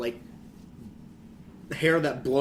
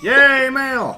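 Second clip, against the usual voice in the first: excited cheer; 12 semitones higher, 13 dB louder than usual. Real, not acted. Cheering game result.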